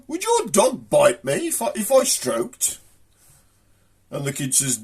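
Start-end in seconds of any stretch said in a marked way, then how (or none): 0.1-2.8 high-pitched posh lady asking; hammed-up posh female voice; posh woman. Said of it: "this posh woman comes up".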